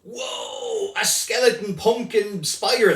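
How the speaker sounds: high, loud amazed shout; excited shout. Real, not acted. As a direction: wow it like a spooky surprise. Reacts to child's scary creature.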